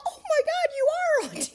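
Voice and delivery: loud, high mock gasp of recognition; amazed discovery. Young men who Googled her recognizing her, amazed. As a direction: imitate starstruck shock, breaking into laughter.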